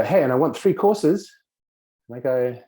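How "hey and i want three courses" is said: acted interruption, higher and louder; demanding guest. Dinner guest calling out to the cook, demanding. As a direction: pipe up loudly with a new demand.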